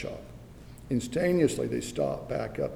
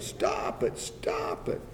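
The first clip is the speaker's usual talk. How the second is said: ordinary talk, nothing marked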